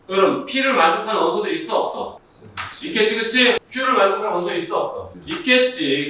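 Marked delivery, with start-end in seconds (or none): none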